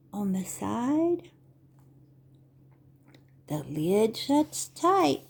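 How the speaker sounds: slow, sing-song picture-book reading; Vorlesen. Acted. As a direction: read to little children, stretching the words with big pauses. Reads picture book to children.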